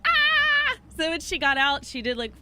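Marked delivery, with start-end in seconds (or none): 0.1-0.8 very high loud squeal; excited scream. Retold or imitated. Creator squealing in her helmet before the ride, high and loud. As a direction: let out a thrilled squeal. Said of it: "she looked at me, and she was like".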